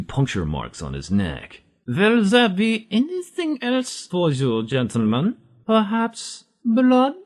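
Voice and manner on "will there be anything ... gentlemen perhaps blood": very high, slow, drawn-out vampire croon; horrible accent vampire. Acted. Vampire bartender offers Simo blood, high and slow. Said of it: "it's the horrible accent vampire".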